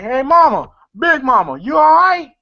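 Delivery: high, loud call to Mama. Retold or imitated. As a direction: imitate calling out to check on someone. Acted calling to Big Mama.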